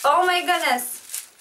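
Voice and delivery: real excited exclamation; excited yell. Real, not acted. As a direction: blurt it out in delight. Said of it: "Oops, am I yelling?".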